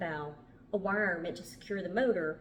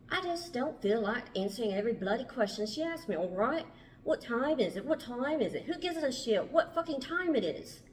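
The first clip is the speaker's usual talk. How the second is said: exasperated, badly faked English accent, high swooping pitch; Mark; 5 semitones higher than usual. Mark rants about being asked the time, exasperated terrible English accent. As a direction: rant irritably in an amateur English accent. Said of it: "my terrible English accent".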